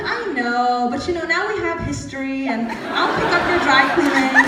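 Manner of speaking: loud, playful mock pleading; cheeky comeback. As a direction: raise your voice into a joking offer. Cheeky comeback to the serious publisher.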